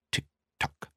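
soft clock-tick imitation, breathy; whispered tick-tock. A narrator's reading. Mimicking the timer mechanism.

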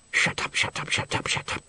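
hushed whispered shut up; urgent whisper. A narrator's reading. Trombonist whispering to the tuba player to hush. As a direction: hiss it at your neighbour. Players hushing each other.

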